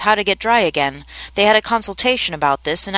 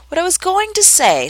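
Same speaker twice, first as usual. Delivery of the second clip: a much higher, put-upon voice, mildly offended; 11 semitones higher than usual. A narrator's reading. The dodo, snubbed by the eaglet, speaks with mild offence. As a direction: speak up with hurt pride. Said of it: "said the dodo in an offended tone".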